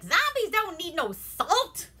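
high, loud and sassy; sassy Samantha. Retold or imitated. Samantha scolds the fake zombie, high and sassy. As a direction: snap it back at him, high and bold. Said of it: "she's like".

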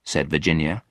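usual speaking voice

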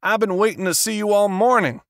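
loud, high, angry shout. A narrator's reading. Frustrated author shouting at the agent. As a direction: yell it at the agent. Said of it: "Ronald Labane shouted".

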